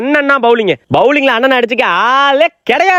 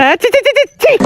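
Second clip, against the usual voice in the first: high, repeated "chi chi" noises; soothing baby noises; 11 semitones higher than usual. Acted. Parent trying to hush baby, coaxing. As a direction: distract a baby with high nonsense sounds.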